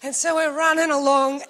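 usual speaking voice

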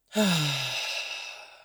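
long exhaled sigh, falling away; demonstrated sigh. Shown as a demonstration. Meditation guide showing listeners how to breathe out, long sigh. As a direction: let the breath out in an audible sigh. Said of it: "You can even add a sigh".

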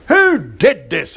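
slow, drawn-out mock demand; a lightly acted angry crowd asking who did it. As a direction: townspeople demanding to know who wrecked the altar, mildly angry. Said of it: "everybody demanded".

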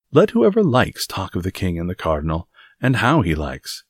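emphatic tirade with swinging pitch; blustering Porthos, reproachful. Acted. Porthos lectures Aramis about respecting the queen, reproachful. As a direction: scold with theatrical exasperation.

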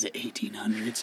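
hushed, playful whisper back; soft mock-mysterious echo; spooky whisper. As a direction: whisper along as if it were eerie.

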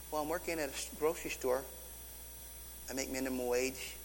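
low, very soft imitated reply; retold sheepish mumble, pitched low; sheepish admission. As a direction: admit it small and quiet, voicing the young man. Young man's admission in retold story.

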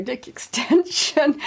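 drawn out, on the edge of laughter; embarrassed punchline. Real, not acted. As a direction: stretch out the awkward words before cracking up. Delivers the embarrassing slip.

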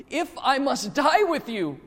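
loud, high, slow, impassioned vow; emphatic. A narrator's reading. Peter swears loyalty to Jesus, loudly emphatic. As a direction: declare it forcefully, drawing out each word. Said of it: "he said to him emphatically".

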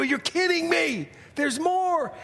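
high, thrilled disbelief; giddy, high-pitched amazement; disbelieving contestant. Retold or imitated. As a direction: imitate a winner who can't believe it. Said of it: "And you go".

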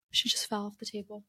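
hushed aside about the kitten; soft aside. Real, not acted. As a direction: drop the voice, speaking off-mic.

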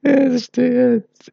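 high, slow mock exasperation; squeaky mock scoff. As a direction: squeal it in fake disgust.

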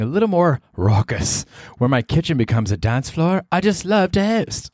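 airy, lilting pop-star voice, rising on the ends. Retold or imitated. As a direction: read it like a glamorous singer's newsletter. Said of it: "I'm just quoting [name] as though I were [name]".

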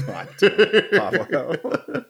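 high, laughing "God damn it"; laughing exasperation. Real, not acted. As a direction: scold through laughter. Friend's crude joke.

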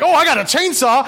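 high, loud gleeful one-upping; excited boast. Retold or imitated. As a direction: brag like a friend topping the last tool. Friend one-upping with chainsaw.

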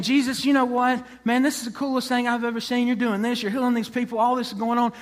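excited, voice lifted throughout; excited follower. Acted. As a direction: gush like an awestruck new follower. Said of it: "this person is very excited".

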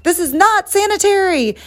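high, squeaky prissy complaint; mocking outraged viewers. Imitating online critics complaining about hygiene, in a high prissy voice. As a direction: mimic the fussy critics way up high. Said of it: "They're like".

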